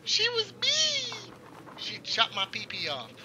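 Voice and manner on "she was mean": put-on high, slow childish whine; whiny child. Streamer complaining to chat about her, pouty childlike whine. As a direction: pout it like a hurt little kid. Whining about the game villain.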